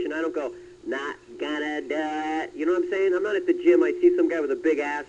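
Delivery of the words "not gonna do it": a light, slow Bush catchphrase; slow, lightly voiced President Bush; George Bush impression. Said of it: "do George Bush".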